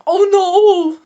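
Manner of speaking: high loud sighing exclamation. Shown as a demonstration. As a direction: sigh it out high. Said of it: "Practice sighing short".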